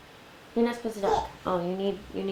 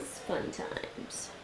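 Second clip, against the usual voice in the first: whispered; 11 dB quieter than usual. Real, not acted. Whispered repeat.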